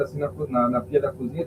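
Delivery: ordinary talk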